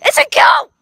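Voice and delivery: high excited yell. Real, not acted. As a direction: yell it out in alarm. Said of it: "[name], stop yelling".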